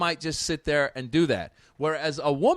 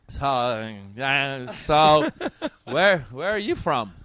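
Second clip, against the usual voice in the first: put-on halting, slurred drunk mumbling; comic tipsy stammer; imitated drunk woman; 2 semitones lower, slower than usual. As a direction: mimic a heckler too drunk to start a sentence. Imitating the drunk lady in the audience.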